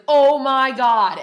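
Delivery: loud and slow, stunned; overwhelmed realization. Retold or imitated. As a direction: awestruck.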